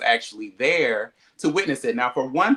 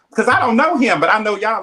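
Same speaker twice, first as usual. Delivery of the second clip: louder, indignant; raised indignant voice; 7 semitones higher, 8 dB louder than usual. Retold or imitated. To friends who left her out, louder and indignant. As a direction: push it out louder, offended. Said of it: "I'm like".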